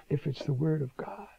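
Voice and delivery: whispered aside; preacher suddenly whispering to the congregation. Acted. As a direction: drop to a whisper. Dropping to a whisper.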